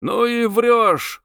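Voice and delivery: high, drawn-out jeer from the crowd; heckling cry. A narrator's reading. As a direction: call out the accusation, stretched and high. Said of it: "крикнул из толпы человек".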